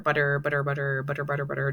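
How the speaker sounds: "butter" repeated in a quick run with tapped middle; "butter" over and over, softly, practising the tongue tap; R-rolling drill. Shown as a demonstration. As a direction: rattle off "butter, butter" as a tongue exercise. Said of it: "roll your R by doing the word butter".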